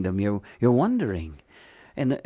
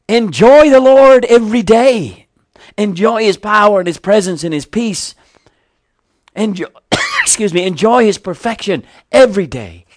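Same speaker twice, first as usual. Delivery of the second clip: louder and higher, pressing the Enjoy lines; fervent exhortation; 9 semitones higher, 10 dB louder than usual. Real, not acted. Preacher to congregation, a fervent soaring exhortation. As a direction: lift the room, urge them to enjoy God.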